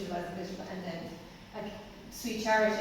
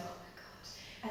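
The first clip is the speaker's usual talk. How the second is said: breathless awed whisper; 15 dB quieter, slower than usual. Retold or imitated. Watching the kid save the scene on stage, whispering in awe. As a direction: whisper it in amazement. Retold awe at child actor.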